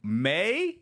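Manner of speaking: high disbelieving question; incredulous. Real, not acted. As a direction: repeat it in disbelief. Incredulous echo of news.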